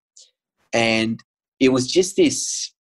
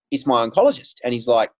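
normal talking voice

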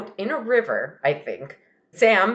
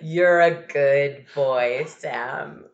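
reluctant dad; 6 semitones lower, much slower than usual. Acted. Dad voiced saying requested words.

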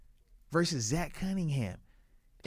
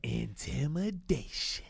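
movie quote; 3 semitones lower, 7 dB quieter, much slower than usual. Retold or imitated. Little Giants movie quote.

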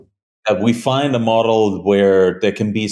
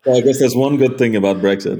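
sarcastic; 4 semitones higher, faster than usual. Real, not acted. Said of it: "Oh, the sarcasm".

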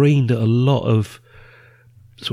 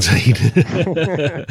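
higher, drawn out, breaking into laughter; laughing; 5 semitones higher, much slower than usual. Real, not acted. Host amused by guest's Monty Python picks, laughing. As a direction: laugh through a long, raised word.

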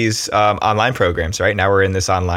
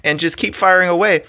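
pumped-up pep talk; 10 semitones higher than usual. Retold or imitated. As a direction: fire yourself up to get back on the horse. Retold pep talk to self.

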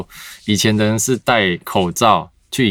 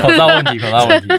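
squeaky echo. Pretend. Echoing joke in squeaky voice.

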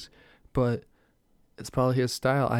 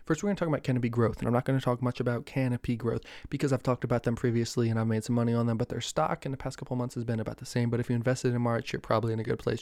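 really fast; faster than usual. Acted. Host rushing stock news like he's on the trading floor, fast. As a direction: rattle it off fast like a stock trader. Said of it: "I'm gonna talk to you really fast".

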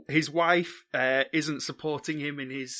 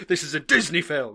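mock outrage; 6 dB louder than usual.

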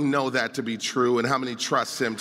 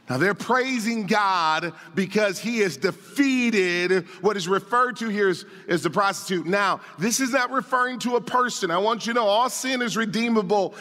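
higher, driving pulpit voice; raised, insistent sermon delivery; impassioned preaching; 7 semitones higher than usual. Real, not acted. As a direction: preach it with urgency, punching key words. Preaches on the passage.

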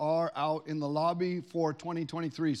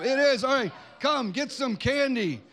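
excited invitation; 7 semitones higher, 6 dB louder than usual. Real, not acted. Host calls the winning kid up for candy, loud and excited. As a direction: summon the winner with big energy.

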